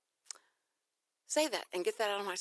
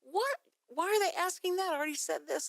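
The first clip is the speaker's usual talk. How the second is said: baffled exasperation; 10 semitones higher than usual. Retold or imitated. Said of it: "it was going like".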